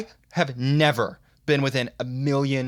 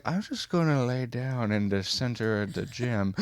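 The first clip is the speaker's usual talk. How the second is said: woozy teacher; 2 semitones lower, 5 dB quieter than usual. Retold or imitated. Acts out the woozy gym teacher.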